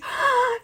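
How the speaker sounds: a sharp, high gasp of delight; real startled gasp of joy; excited gasp. Real, not acted. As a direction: gasp as the surprise lands. Opens a gift note from a viewer.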